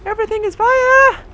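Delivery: very high, loud squealed exclamation; high-pitched cry of alarm. Real, not acted. Streamer suddenly finding everything burning, high loud squeal. Startled by fire in game.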